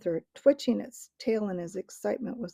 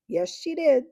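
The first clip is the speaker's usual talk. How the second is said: higher, louder cheeky squirrel retort; even perter; 7 semitones higher, 6 dB louder than usual. A narrator's reading. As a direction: top the other squirrel, cheekier. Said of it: "even perter".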